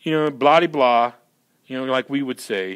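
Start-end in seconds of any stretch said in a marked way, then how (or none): 0.0-1.1 loud, raised "ah", then blah-blah; loudly waving it off with blahs; dismissive Hebrew inflection. Retold or imitated. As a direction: imitate a dismissive Hebrew idiom. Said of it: "it's like a Hebrew inflection".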